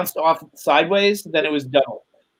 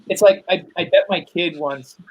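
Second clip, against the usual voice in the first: usual speaking voice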